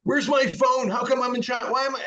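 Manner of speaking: patient waking after surgery, screaming in high mock panic; retold frantic scream. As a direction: replay the confused panic, pitch way up. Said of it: "I was screaming, yelling".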